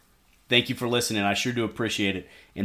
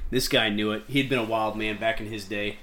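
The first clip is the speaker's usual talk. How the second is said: usual speaking voice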